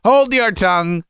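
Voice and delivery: high, sudden cut-in; father silencing his son; angry shout. A narrator's reading. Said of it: "the father shouted at him".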